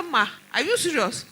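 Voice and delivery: high, loud Ma are you serious; shocked. Retold or imitated. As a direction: gasp it in disbelief. Resident's shocked reply.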